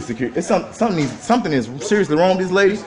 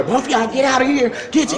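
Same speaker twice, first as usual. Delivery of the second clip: imitated yelling; 6 semitones higher than usual. Man telling security what she said, mild mock yell. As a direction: mimic her ordering them out. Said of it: "She was just yelling".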